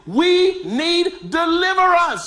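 high, slow proclamation; emphatic. Acted. Pastor declaring the need for deliverance, high and slow. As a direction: lift it up high. Climax line.

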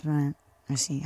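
ordinary talk, nothing marked

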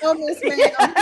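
laughing repeat. Retold or imitated. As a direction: crack up mid-phrase.

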